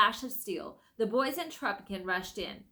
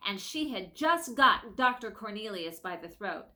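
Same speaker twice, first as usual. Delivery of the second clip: plain reading voice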